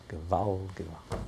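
low, soft cry of pain; subdued lament. Retold or imitated. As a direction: utter it softly, as if written in anguish. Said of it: "Alter Rebbe can't contain himself".

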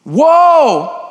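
a high, loud cry of awe; startled whoa. Acted. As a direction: throw out a sudden shouted whoa. Preacher's sudden exclamation.

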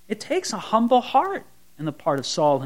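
ordinary delivery

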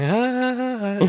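high, hollow put-on laughter; a lightly mocking forced chuckle; fake polite laugh. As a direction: laugh along without getting the joke. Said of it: "he's like".

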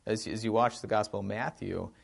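normal talking voice

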